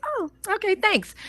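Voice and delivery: a surprised, shy little thank you; flattered stranger. Retold or imitated. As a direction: stranger in a store, startled by a compliment. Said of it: "they'll be like".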